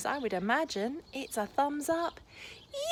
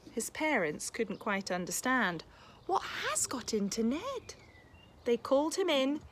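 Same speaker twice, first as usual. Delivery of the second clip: lively, expressive picture-book read-aloud; reading to children; 4 dB louder than usual. A narrator's reading. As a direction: read it like a bedtime story for a class.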